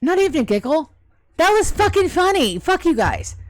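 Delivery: high, loud protest; offended comedian demanding a laugh; indignant outburst. Real, not acted. Joke got no laugh, mock outrage.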